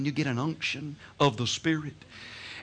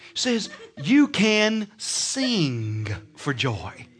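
ordinary talk

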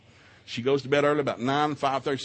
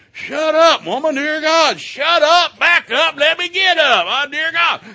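high, loud mock screaming match; hammed-up shout at his wife; comic yelling; 12 dB louder, slower than usual. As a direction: bellow it like a grumpy husband woken up. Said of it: "we're yelling at one another".

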